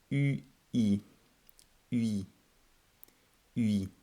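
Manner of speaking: U I, glided u into ee; diphthong demo. Shown as a demonstration. Teacher modelling the diphthong for students. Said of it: "we have upsilon iota".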